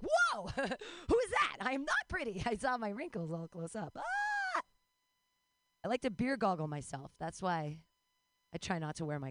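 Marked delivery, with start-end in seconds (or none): 0.0-2.4 high, hammed-up alarm with a laugh; startled squawk, played for laughs; mock shock. Comic seeing herself up close in the mirror, mock shock. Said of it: "and I'm like". 4.0-4.6 high comic shriek; hammed-up scream of horror; mock scream. Comic horrified at her own wrinkles, mock scream.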